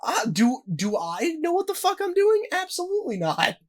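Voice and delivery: squeaky high lightly voiced Logan Paul; mock Logan Paul. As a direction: play him baffled at being asked. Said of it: "became self-aware and was like".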